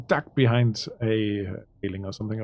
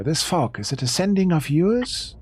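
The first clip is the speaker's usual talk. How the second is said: lightly voiced character, higher with a rising question; 6 semitones higher, 3 dB louder than usual. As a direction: put a pointed question in character, pitch climbing. Game master acts character's question.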